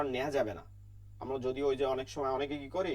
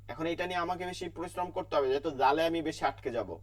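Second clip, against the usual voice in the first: ordinary delivery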